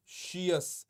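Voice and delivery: "शियस" drawn out as shi-us; teacher's careful "शियस", both sounds distinct; pronunciation demo. Shown as a demonstration. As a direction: model "शियस" slowly with a clear sh. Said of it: "ऐसे pronounce करो".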